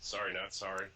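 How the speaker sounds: ordinary talk, nothing marked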